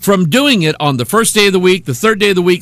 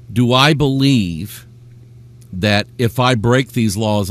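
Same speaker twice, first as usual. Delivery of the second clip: ordinary delivery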